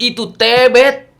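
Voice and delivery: put-on loud, high, slow "itu tebet" in a Batak accent. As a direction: insist it's tebet, Batak style. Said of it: "kata orang Batak".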